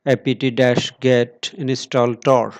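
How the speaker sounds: usual speaking voice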